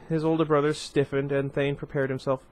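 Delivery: usual speaking voice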